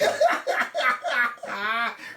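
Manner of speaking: high, continuing laughter; real amused laugh. Real, not acted. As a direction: keep chuckling at the tease. Still laughing at the tease.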